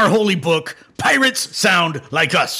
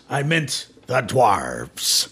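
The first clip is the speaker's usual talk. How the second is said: very slow, weighty reveal; slow dramatic reveal; 6 semitones lower, 3 dB quieter, much slower than usual. Acted. Dwarf god revealing the blame to the cleric, very slow. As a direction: drop each word heavily.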